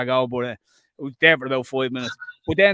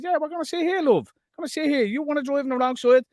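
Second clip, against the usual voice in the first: mocking high voice; 9 semitones higher than usual. Mocking drivers blocking the wrong-side woman, high sneer.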